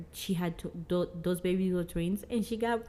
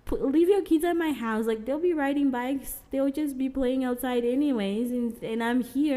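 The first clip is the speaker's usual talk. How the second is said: sympathetic reassurance; 6 semitones higher than usual. Retold or imitated. Comforting the struggling mother.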